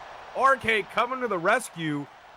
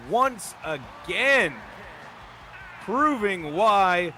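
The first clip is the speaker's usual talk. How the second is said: ordinary delivery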